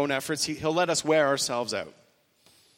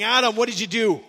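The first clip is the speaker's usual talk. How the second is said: loud, higher accusing yell at Adam; imagined yelling; 6 semitones higher, 6 dB louder than usual. Retold or imitated. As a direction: bark the question like a stern judge.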